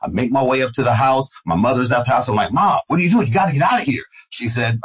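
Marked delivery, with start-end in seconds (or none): 2.5-4.0 higher-pitched urgent plea to mom. Retold or imitated. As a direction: press her to leave, alarmed. Urging mother to evacuate.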